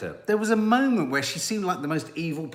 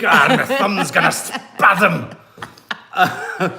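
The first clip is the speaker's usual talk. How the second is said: louder, slow, laughing; laughing strain; 9 dB louder, much slower than usual. Real, not acted. Mimicking the thumb-holding strain.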